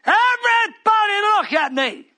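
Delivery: loud, high commanding call; shout. Real, not acted. Preacher shouting to congregation for attention. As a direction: demand every eye on you.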